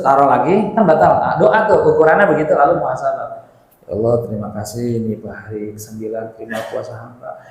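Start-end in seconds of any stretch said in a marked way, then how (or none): 3.9-7.4 soft, low murmured prayer; doa berbuka. Acted. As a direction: pray humbly, low and soft. Acts out a fast-breaking prayer. (doa lirih dengan nada rendah)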